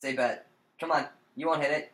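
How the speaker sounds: ordinary talk, nothing marked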